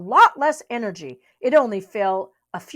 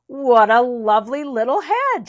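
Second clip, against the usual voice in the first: calling out; 3 semitones higher, 6 dB louder than usual. A narrator's reading. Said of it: "shouted Bear from her hilltop".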